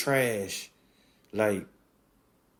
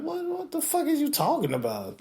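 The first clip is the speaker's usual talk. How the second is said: much higher squeak of disbelief; bewildered. Retold or imitated. Fan reacting to lyrics, very high bewildered squeak. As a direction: squeal it in total confusion. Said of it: "I'm like".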